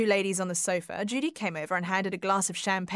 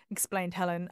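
usual speaking voice

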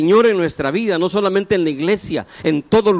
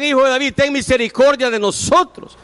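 higher, pleading mock cry to Jesus; crying out for mercy; 6 semitones higher, 4 dB louder than usual. Blind men calling out to Jesus, raised pleading cry. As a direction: call out like the blind men begging. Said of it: "ellos gritan".

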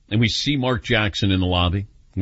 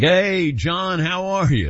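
hearty greeting; 6 semitones higher, slower than usual. Retold or imitated. Patrick greets John warmly.

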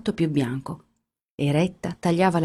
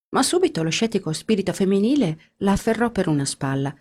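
ordinary delivery

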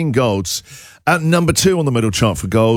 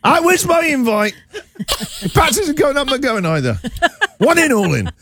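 hammed-up wounded whine, raised pitch; mock hurt; 11 semitones higher than usual. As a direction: play the snubbed star. Mock sulk over missing invite.

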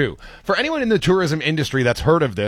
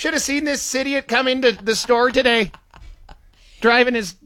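higher-pitched small-town griper; lightly acted rural complainer, voice raised in pitch; rural local griping; 8 semitones higher than usual. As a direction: play a country local grumbling, pitched up. Imagined rural local griping about city people.

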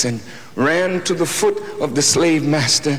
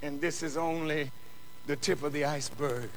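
much softer, intense hushed sermon; hushed preaching; 8 dB quieter than usual. Acted. Preacher to congregation about the pain of Jesus, hushed. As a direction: drop to a near hush to draw them in.

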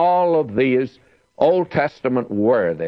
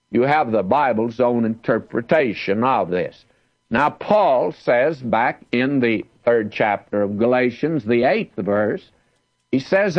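normal talking voice

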